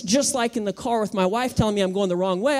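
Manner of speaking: normal talking voice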